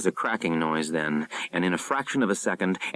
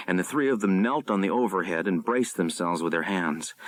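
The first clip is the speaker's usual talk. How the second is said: ordinary delivery, nothing marked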